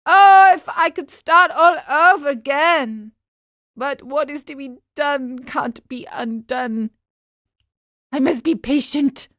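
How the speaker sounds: plaintive, high-pitched regret; weak crying voice. A narrator's reading. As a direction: wail the wish, then give in sorrowfully. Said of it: "cried out in a weak voice".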